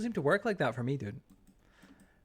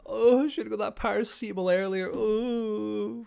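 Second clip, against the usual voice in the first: high, drawn-out mock groaning; hammed-up regretful whinge; whinging and moaning; 8 semitones higher, 3 dB louder than usual. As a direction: moan it like a self-pitying patient. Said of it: "fucking whinging and moaning".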